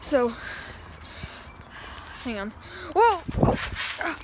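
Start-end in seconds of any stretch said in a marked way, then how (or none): 2.9-4.2 startled. Real, not acted. Falls off the trampoline.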